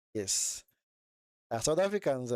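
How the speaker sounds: ordinary talk, nothing marked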